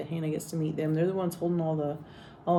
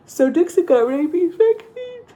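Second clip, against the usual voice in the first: yawning through the words; stretched out mid-yawn; 13 semitones higher, 10 dB louder, slower than usual. Real, not acted. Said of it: "Why are you yawning?".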